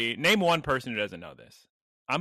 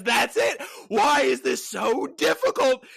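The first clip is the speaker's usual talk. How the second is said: loud, high, furious customer; angry shout; 12 semitones higher, 4 dB louder than usual. Retold or imitated. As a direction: yell it like a fed-up customer. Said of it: "back inside angrier and angrier".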